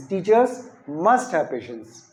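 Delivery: slow, emphatic "must"; teacher modelling forceful emphasis on "must"; stress on must. Shown as a demonstration. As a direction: punch "must" in a drawn-out sentence. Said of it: "जोर देके बोलेंगे".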